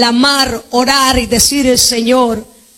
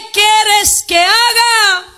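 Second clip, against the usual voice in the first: slow question; 8 semitones higher, much slower than usual. Acted. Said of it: "te está preguntando".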